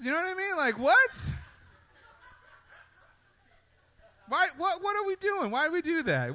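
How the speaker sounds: high-pitched baffled rant; incredulous. Real, not acted. Comic ranting to LA crowd, high incredulous disbelief. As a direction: squeak out total disbelief.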